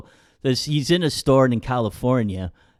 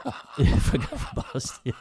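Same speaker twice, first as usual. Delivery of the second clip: words squeezed through breathless laughter; laughing; 3 semitones higher, slower than usual. Real, not acted. Echoing the co-host's dig at Boston, laughing. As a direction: repeat it slowly while cracking up.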